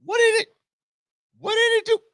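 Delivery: very high, squeaky, stretched puzzlement; cartoon dog asking what just happened; confused dog voice. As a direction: squeak it like a baffled little dog. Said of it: "he looked at the street like".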